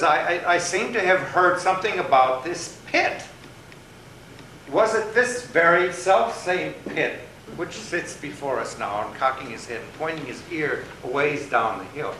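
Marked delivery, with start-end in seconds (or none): 0.0-9.1 higher, louder, deliberate rabbit voice; lightly voiced storybook rabbit. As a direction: stretch it out like a pondering rabbit.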